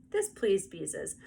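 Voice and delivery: plain reading voice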